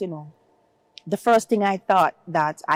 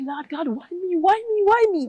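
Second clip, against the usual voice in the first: retold crying plea; 11 semitones higher than usual. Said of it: "And I keep crying".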